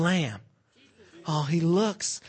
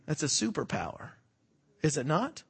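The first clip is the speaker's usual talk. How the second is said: ordinary talk, nothing marked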